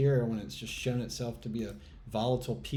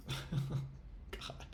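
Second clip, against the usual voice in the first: breathy laugh; 4 semitones higher, 11 dB quieter than usual. Real, not acted. Laughing at own misery.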